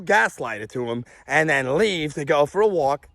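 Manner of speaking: usual speaking voice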